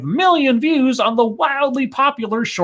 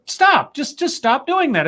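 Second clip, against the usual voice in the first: exasperated; 8 semitones higher than usual. Real, not acted. Exasperated plea to stop strokes.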